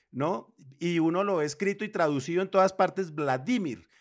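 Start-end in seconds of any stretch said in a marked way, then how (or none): 3.1-3.9 "Vladimir" as vla-DÍ-mir, stress on the middle syllable; Vladimir as palabra grave. Shown as a demonstration. Panelist showing correct Spanish form, "Vladimir" stressed on DI. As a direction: say "Vladimir" punching the DI. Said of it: "es palabra grave".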